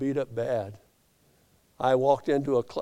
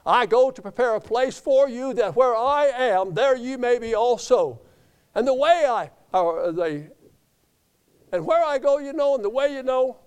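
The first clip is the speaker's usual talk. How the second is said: preaching at full force; 7 semitones higher, 4 dB louder than usual. Acted. Preacher's rising scripture quote.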